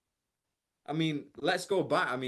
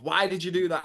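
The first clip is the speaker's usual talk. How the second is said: scolding parent; 5 semitones higher, 5 dB louder than usual. Retold or imitated. Parent tells child off.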